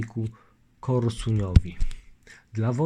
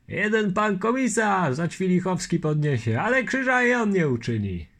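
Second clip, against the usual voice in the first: higher, louder crowd voice; chorus of nobles; 8 semitones higher, 4 dB louder than usual. Acted. Nobles answering the lieutenant, a raised crowd reply. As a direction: several nobles calling out.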